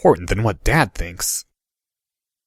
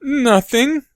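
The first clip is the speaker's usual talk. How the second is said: strangled voice. A narrator's reading. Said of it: "in a strangled voice".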